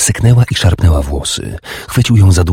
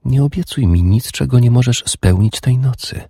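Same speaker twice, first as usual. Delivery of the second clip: higher, gently pleading; soft woman's voice; 7 semitones higher than usual. A narrator's reading. Urdis asking her lover for this night. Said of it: "szepnęła".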